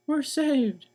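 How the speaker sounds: high, loud relieved cry; joyful cry. Acted. Missus Watson sees the firemen arrive: high joyful cry. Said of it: "cried Missus Watson".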